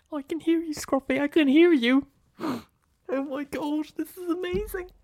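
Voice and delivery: very high, soft squeaky joy; thrilled dog owner. Acted. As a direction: marvel in a tiny giddy voice.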